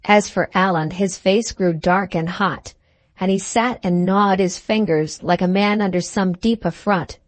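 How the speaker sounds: ordinary delivery, nothing marked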